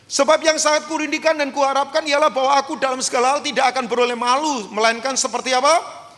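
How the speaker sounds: loud, high-pitched proclamation; preacher at full volume, voice held high; full-force preaching. Real, not acted. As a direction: drive the scripture home to the crowd.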